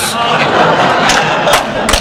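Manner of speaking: airy unvoiced laugh after the punchline; breathy laugh. Real, not acted. Pastor laughing at his own joke to the congregation, airy laugh.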